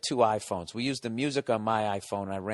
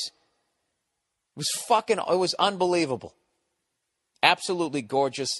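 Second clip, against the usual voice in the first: awestruck; 7 semitones higher than usual. Real, not acted. Awed by desert vultures.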